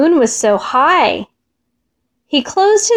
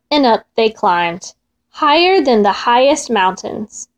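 ordinary delivery, nothing marked

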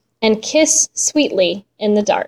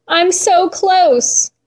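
high, slow, yearning plea; begging; 7 semitones higher, slower than usual. Acted. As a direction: stretch out so, pleading. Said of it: "she begged".

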